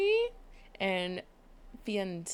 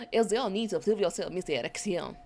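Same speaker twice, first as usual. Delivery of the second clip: louder, hammed-up French accent; 6 dB louder than usual. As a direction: redo the line in a French accent. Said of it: "Oh, a French accent".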